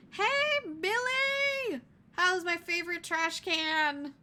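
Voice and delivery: high, loud, drawn-out animatronic call; sing-song menace, stretching every word; creepy animatronic. Acted. Animatronic calls to trapped survivor.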